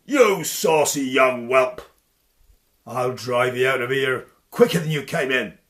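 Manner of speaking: bellowing giant threat; giant's voice. Acted. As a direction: growl down at the upstart. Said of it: "bellowed Denbras".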